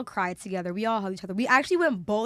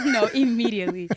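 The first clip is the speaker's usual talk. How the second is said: louder words broken by laughter; laughing agreement; 6 dB louder than usual. Real, not acted. Urging friend to see a doctor, laughing agreement. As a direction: agree while still cracking up.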